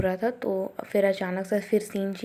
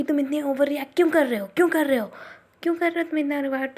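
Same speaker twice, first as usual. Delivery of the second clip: higher, louder, quick exasperated yell; annoyed shout; 6 semitones higher, 4 dB louder, faster than usual. Retold or imitated. Girl yelling at the overreacting boy, high loud shout. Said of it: "ये चिल्ला के बोलती है कि".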